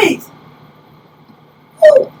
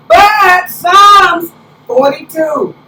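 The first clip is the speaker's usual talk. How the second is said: very slow, louder announcement; announcing scripture; 3 semitones higher, 5 dB louder, much slower than usual. Real, not acted. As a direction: drag the passage number out to call everyone back. Calling the congregation back to scripture.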